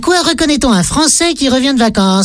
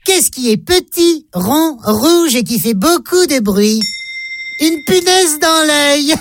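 slow, higher riddle voice; 5 semitones higher, slower than usual. Acted. Riddle told in a put-on voice.

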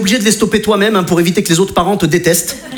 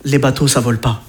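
operator voice; 8 semitones lower than usual. Retold or imitated. Quoted answer.

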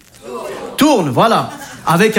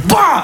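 effort grunt; 8 semitones higher than usual. Retold or imitated. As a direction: throw the punch with a shout. Punch effort.

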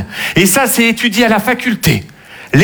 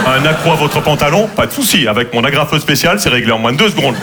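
ad voice; 6 semitones lower than usual. Pretend. Mock Carglass ad voice.

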